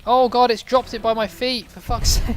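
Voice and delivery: real startled shout breaking into a laugh; panicked shout. Real, not acted. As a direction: yelp in alarm as the dynamite lands at your feet. Dynamite dropped at his feet.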